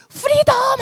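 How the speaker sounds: high, loud Elsa-style cry; soaring, exultant yell; triumphant shout. Retold or imitated. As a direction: shout it out high, imitating the ice queen. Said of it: "엘사가 외칩니다".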